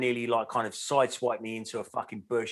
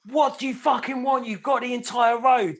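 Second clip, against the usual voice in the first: much higher, louder furious yell; retold angry yell; 12 semitones higher, 5 dB louder than usual. Cyclist reliving yelling at a beeping driver, much higher and louder. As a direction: bark it at the driver like you've lost it.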